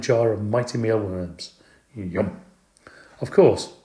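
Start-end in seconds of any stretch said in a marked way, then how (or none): 1.9-2.4 soft hungry whisper; whispered yum. A narrator's reading. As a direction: breathe it out with longing. Hungry hamster sees food.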